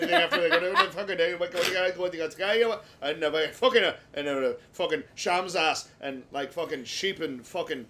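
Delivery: loud, hammed-up Scottish gibberish; Scottish brogue. Guest asked for a brogue the host says nobody understands, mock Scottish rant. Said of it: "Do a Scottish brogue".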